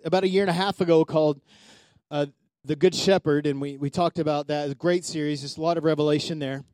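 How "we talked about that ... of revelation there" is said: ordinary delivery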